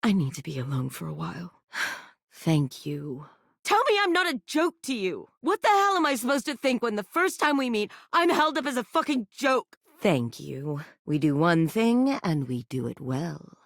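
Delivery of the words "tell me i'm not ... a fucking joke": fast, much higher hurt rant; anguished outburst. Acted. Toy confronting the player about first meeting, fast hurt rant. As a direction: rush it out high and wounded.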